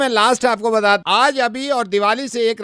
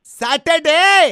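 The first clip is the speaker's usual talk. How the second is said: loud, high, drawn-out demand; rude tone; 4 semitones higher, 5 dB louder, much slower than usual. Acted. Suitor names a meeting day, curtly demanding. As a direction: stretch the one word out like an order. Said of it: "ओफो, आपकी tone".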